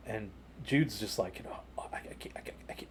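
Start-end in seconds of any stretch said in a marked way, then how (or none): 1.8-2.9 whispered, faltering stammer; starstruck stutter. Retold or imitated. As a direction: imitate a tongue-tied fan, barely any voice. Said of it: "and he's stuttering".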